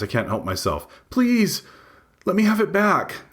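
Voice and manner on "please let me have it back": much higher, tearful begging; tearful plea. A narrator's reading. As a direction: beg for the phone near tears. Tears well up in my eyes.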